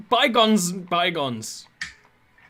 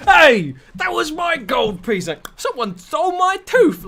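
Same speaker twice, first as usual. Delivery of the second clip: angry pirate; 13 semitones higher, 7 dB louder than usual. Retold or imitated. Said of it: "someone's like".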